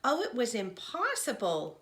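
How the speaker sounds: plain reading voice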